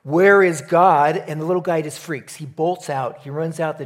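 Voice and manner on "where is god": very loud and slow, full booming pastor's demand; biggest, deepest voice. Retold or imitated. Pastor's final try at the frozen boy, booming and slow. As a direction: blast it out like thunder from the pulpit. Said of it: "in his biggest and deepest voice".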